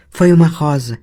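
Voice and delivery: put-on the girl calmly explaining the blood; calm young girl. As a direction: say it like a calm child playing down a wound. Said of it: "disse a menina calmamente".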